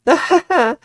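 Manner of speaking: high, loud short laugh; nervous laugh. Acted. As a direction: laugh off the hug. Nervous laugh dodging hug.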